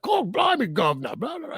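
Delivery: high-pitched, hammed-up Cockney; thick Cockney accent. Co-host imagining an actor nobody could understand, thick Cockney accent. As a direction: put on a cartoon Cockney accent. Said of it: "like, a thick Cockney accent".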